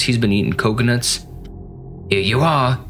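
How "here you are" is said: put-on British waiter serving up; lilting, over-polite British server; British psychopathic murderer. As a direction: announce the order in mock British. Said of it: "like, a British psychopathic murderer".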